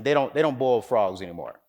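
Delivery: ordinary talk, nothing marked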